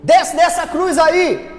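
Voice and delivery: zombaria alta e aguda, rindo; zombaria arrogante. Retold or imitated. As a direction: zombe com arrogância. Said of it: "a arrogância humana". (ladrão zombando de Jesus na cruz, alto e arrogante)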